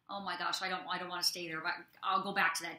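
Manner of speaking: ordinary talk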